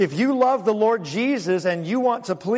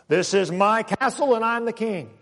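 domineering husband; 4 semitones higher than usual. Retold or imitated. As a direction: puff up as the self-made king of the castle. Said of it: "That was his philosophy".

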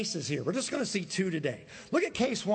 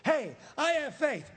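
pitched up, mock-pious boast; mock believer; 8 semitones higher than usual. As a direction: lampoon the hollow believer. Mocking a hypocrite's claim of faith.

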